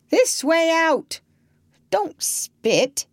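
slow, high, wondering read-out; lightly puzzled reading; relaying odd words. Acted. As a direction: spell out strange phrases.

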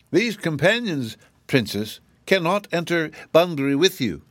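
high, dutiful official rabbit; officious rabbit. A narrator's reading. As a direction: explain the rules self-importantly. Rabbit refuses pets.

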